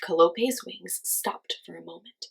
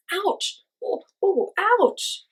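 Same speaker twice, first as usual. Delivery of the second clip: high, loud pained yelps; yelping; 12 semitones higher, 5 dB louder, much slower than usual. A narrator's reading. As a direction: yelp as thorns scratch your nose. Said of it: "he yelped".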